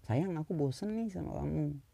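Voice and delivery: gently telling partner. Retold or imitated. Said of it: "Kayak misalnya".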